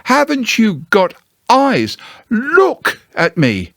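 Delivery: high, slow, exasperated Piglet; mild mock shout. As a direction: plead to be seen. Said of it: "shouted Piglet".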